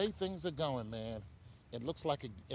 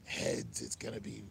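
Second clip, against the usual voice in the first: lightly voiced villain, a bit lower; Two-Face voice; 4 semitones lower than usual. Streamer flipping a coin to predict the game, villain voice. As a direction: put on a hint of a comic-book villain. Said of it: "That's my Two-Face voice".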